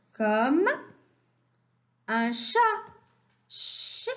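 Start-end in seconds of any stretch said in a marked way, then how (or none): 3.5-4.1 "ch" as a long voiceless shhh; isolated ch sound. Shown as a demonstration. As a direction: hold "ch" softly, with no vowel. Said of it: "donnent le son". (« ch » soufflé et prolongé, sans voyelle)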